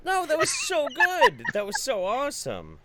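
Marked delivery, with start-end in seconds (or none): 0.0-1.4 excited praise. Real, not acted.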